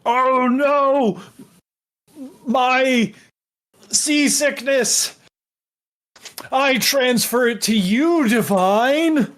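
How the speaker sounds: a melodramatic lady passing on her curse; silly high character voice. Acted. Ferris dumping seasickness on Devine, high hammed-up voice. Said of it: "She's like".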